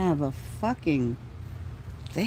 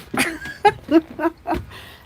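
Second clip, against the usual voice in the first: high laugh; 10 semitones higher than usual. Real, not acted. Laughing again.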